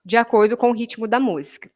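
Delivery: ordinary talk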